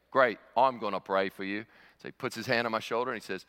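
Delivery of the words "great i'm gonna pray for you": matter-of-fact Aussie impersonation; Australian accent. Retold or imitated. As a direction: imitate an eager Australian bloke. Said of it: "this big Australian guy".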